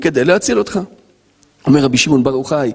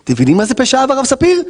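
normal talking voice

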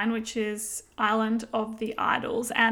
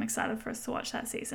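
whispering; 8 dB quieter, faster than usual. Real, not acted. Secret aside to co-host.

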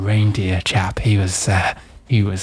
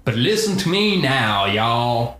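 put-on Texan accent. Santa explaining his confusion to the general, Texan accent. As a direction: play Santa as a drawling Texan. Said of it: "my accent had gone haywire".

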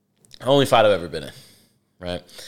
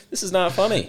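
tag; 9 semitones higher than usual. Retold or imitated. Kid protests as coach laughs, retold.

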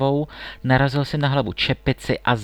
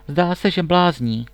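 higher, slow princess's outcry; exclaiming; 5 semitones higher, slower than usual. A narrator's reading. Princess hearing the price of a hundred kisses, exclaiming. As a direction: call it out, drawing the words out. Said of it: "zvolila princezna".

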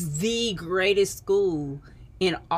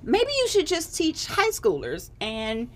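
aunt's voice; 4 semitones higher than usual. Retold or imitated. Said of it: "she was like".

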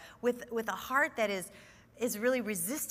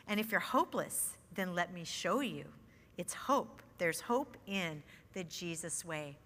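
reading lyrics; 3 semitones lower than usual. Acted. Teacher reading [name] lyrics to her study, prayerful. Reads song lyrics aloud.

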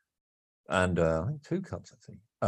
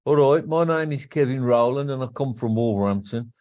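broad Wolverhampton accent, a touch higher and louder; 5 semitones higher, 4 dB louder than usual. Shown as a demonstration. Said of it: "this is Wolverhampton. I talk".

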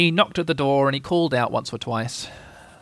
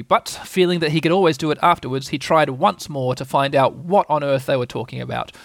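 ordinary delivery

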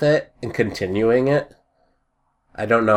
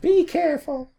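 high, softer mock fretting; Tori; 6 dB quieter than usual. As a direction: falsetto imitation of a worried warning. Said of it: "where she's like".

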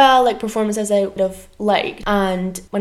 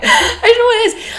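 laughing through tears; 9 dB louder than usual. Real, not acted. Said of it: "I actually feel quite emotional".

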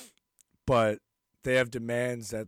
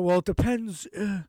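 mocking Biden; 6 semitones higher than usual. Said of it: "he's like".

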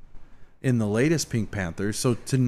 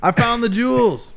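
Ernest impression; 12 semitones higher, 3 dB louder than usual. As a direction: bellow it like dopey Ernest.

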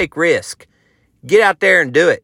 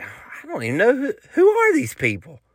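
puzzled; 7 semitones higher than usual. Retold or imitated. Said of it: "and I'm like".

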